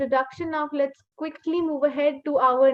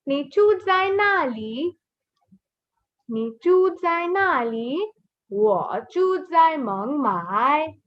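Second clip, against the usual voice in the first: slow, drawn-out Mandarin for learners; slow model reading; slower than usual. Shown as a demonstration. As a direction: model each syllable slowly and clearly. Teacher models sentences slowly for learners.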